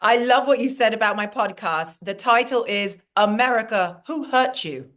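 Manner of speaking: put-on chatty British accent; British-accented host addressing an audience; British alter ego. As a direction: play a gracious British guest speaker. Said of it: "bring my British alter ego".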